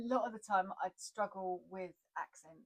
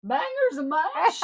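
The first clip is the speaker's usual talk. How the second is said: slow, loud, high hammed-up American; husband mocking her American accent, loud and slow; 8 semitones higher, 14 dB louder, much slower than usual. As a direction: drawl it out as a bad American. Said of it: "making fun of me in my bad American accent".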